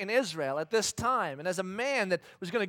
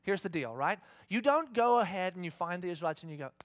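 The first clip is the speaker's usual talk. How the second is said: ordinary talk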